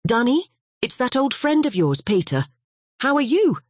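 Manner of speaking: bright, high friendly greeting; cheerful old friend. Acted. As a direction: hail an old pal warmly.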